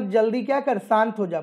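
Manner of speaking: ordinary delivery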